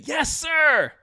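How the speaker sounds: loud, high military acknowledgement; instinctive shouted reply. Retold or imitated. Marine answering his yelling platoon sergeant mid-run, shouted. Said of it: "Instinctively, I say".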